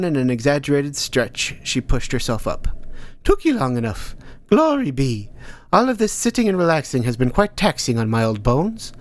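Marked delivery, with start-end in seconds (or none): 3.2-8.9 put-on higher old woman's voice, amused and dry; a crone teasing her tired pupil; wry old teacher. Old teacher Hilda Dee speaks.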